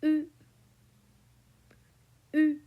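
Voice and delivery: U said with rounded lips and an ee tongue; French U. Shown as a demonstration. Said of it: "repeat after me".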